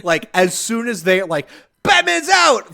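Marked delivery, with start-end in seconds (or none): 1.8-2.7 high, loud mock cop yell; shouted alarm. Cop alerting the station to an escape, shouting. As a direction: raise the alarm to the whole floor.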